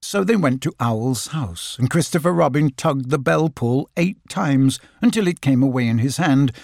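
ordinary delivery, nothing marked

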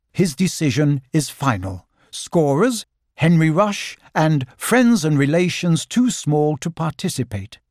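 ordinary delivery, nothing marked

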